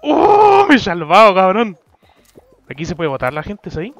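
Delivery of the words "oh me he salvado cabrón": loud relieved yelling; excited shout. Real, not acted. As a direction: shout in triumph. Narrow escape in game.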